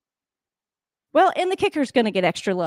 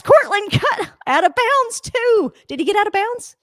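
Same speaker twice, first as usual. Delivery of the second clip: high excited laughing call; excited disbelief; 11 semitones higher, 4 dB louder than usual. Real, not acted. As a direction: squeal it through a laugh at the play.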